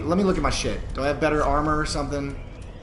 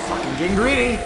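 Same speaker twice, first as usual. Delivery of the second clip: frustrated yell; 9 semitones higher, 4 dB louder than usual. Real, not acted. Self-scolding after death.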